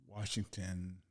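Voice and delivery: ordinary talk, nothing marked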